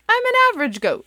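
very high, loud little goat voice; Gregory the goat. Acted. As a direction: squeak it out proudly like a kid goat. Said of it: "said Gregory".

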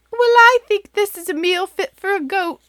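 very high, loud, prim goat mom; Mother Goat. Acted. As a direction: declare it like a proud hostess. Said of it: "said Mother Goat".

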